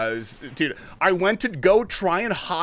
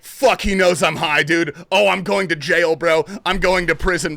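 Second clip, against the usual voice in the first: louder, rushed panic about going to jail; panicked inner voice; 7 dB louder than usual. Retold or imitated. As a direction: spiral into panic out loud. Panicked thought, sure he's caught.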